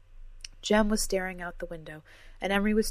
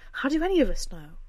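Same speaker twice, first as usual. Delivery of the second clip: loud, higher angry retort; snapped; 8 semitones higher, 7 dB louder than usual. A narrator's reading. Cora snaps at the others, loud and angry. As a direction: throw the question back hard. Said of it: "Cora snapped".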